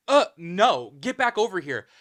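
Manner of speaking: put-on loud, bossy snap at her boyfriend; sharp command. Girlfriend ordering boyfriend back, loud bossy snap. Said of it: "and she says".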